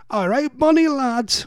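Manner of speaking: high, hammed-up mock Geordie; attempted Geordie accent. Host imitating listener Alan's greeting, attempted Geordie accent. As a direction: badly fake a sing-song Geordie greeting. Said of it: "I can't do accents".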